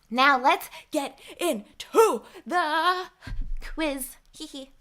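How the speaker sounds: high, drawn-out mock-dramatic launch; playful announcement. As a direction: stretch it out high, ending in a little giggle. Playful announcement of the quiz start.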